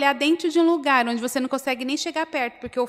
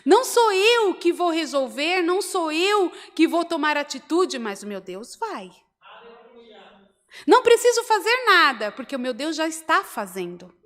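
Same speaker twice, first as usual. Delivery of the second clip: declaration of faith; 5 semitones higher, 3 dB louder than usual. Shown as a demonstration. Preacher modelling what believers should say, emphatic declaration. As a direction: proclaim it with conviction to the congregation. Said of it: "Você consegue dizer assim".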